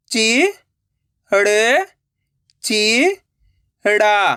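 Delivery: चि, हड़, ची, हड़ा each held alone; syllable by syllable. Shown as a demonstration. Teacher breaking a word for learners, syllable by syllable. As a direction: sound out चि, हड़, ची, हड़ा singly. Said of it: "एक अक्षर का उच्चारण करके".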